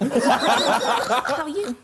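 slow, drawn-out loud mermaid voice; soft bubbly underwater voice. Pretend. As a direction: play a mermaid greeting a visitor. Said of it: "pretend you're a mermaid on the chair".

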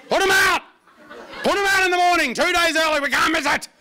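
high, loud panicked shouting; frantic old coot. Acted. Old coot shouting about bin night. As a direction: yell it like a frantic old neighbour.

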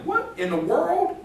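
high, drawn-out astonished question; acted incredulous squeal; mock disbelief. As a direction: stretch it out high, as if scandalised. Acts out shocked reaction to leaders.

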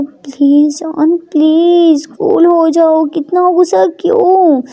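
slow, drawn-out pleading; coaxing plea. Acted. Ishani asks her upset darling to cool down, coaxing. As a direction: stretch the words out to coax her.

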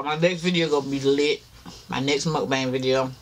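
ordinary delivery

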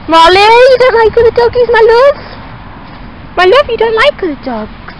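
high, sing-song pet talk. Real, not acted. Cooing to her dog.

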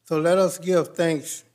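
usual speaking voice

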